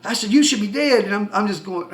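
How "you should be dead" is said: raised, louder retold exclamation; excited. Driver amazed the man is standing, excited exclamation. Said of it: "I get excited easy".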